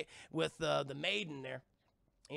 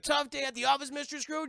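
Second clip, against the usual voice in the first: high, louder chauffeur small talk; chirpy driver; 8 semitones higher, 4 dB louder than usual. Retold or imitated. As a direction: greet the boss cheerily, pitched up. Chatty driver greets grumpy boss.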